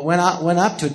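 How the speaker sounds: ordinary talk, nothing marked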